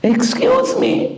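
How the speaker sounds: slow, high, drawn-out excuse me; singer's high excuse me. Retold or imitated. Singer to the rabbi, slow and high.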